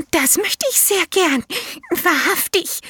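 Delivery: high, eager little elephant voice; elephant child. A narrator's reading. Elephant child answers the python in a high childlike voice.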